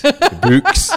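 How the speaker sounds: The books, high and loud with a Scottish vowel; Scottish accent. Shown as a demonstration. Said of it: "It's my Scottishness coming out".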